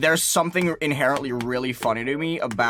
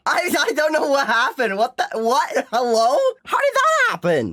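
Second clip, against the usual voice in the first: shocked disbelief; 14 semitones higher, 3 dB louder than usual. Real, not acted. Baffled by a sudden game event.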